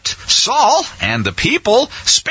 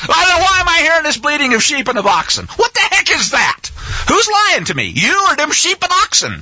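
higher, rising indignant questions; exasperated rebuke; 8 semitones higher than usual. Acted. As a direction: demand answers like a fed-up prophet. Samuel's paraphrased rebuke.

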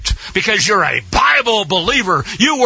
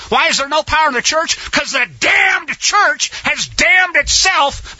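higher, shouted fire-and-brimstone preaching; preaching at full force; 5 semitones higher than usual. Acted. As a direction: rail at the church from the pulpit.

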